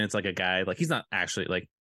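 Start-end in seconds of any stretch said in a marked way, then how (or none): none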